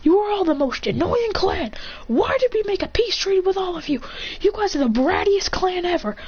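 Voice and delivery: high-pitched, indignant tirade; rage and anger. Retold or imitated. Boy lashing out at the clan that shamed him, enraged. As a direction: rant at them, pitch riding high throughout. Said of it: "in rage and anger, saying".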